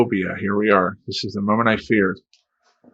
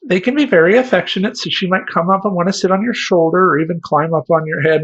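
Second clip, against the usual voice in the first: high, loud cheerful clerk; breezy sales-girl voice; chirpy clerk; 9 semitones higher, 5 dB louder than usual. Retold or imitated. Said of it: "the girl's going".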